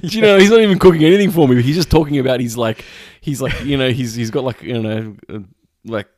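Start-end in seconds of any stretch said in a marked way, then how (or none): 0.0-2.4 loud, high, fast and laughing; excited laughing. Real, not acted. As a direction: rush it out laughing.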